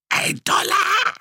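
very high, slow strangled croak. Acted. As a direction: squeeze it out with a choked throat. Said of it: "krächzte er".

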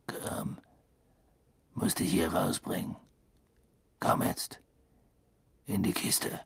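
hoarse, urgent whisper; rough whisper. A narrator's reading. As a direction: whisper it roughly, low and hidden. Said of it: "raue, flüsternde Stimme".

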